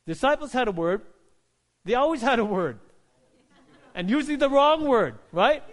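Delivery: loud, high, indignant sermon delivery; full-force preaching. Real, not acted. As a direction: drive it at the congregation, loud and high.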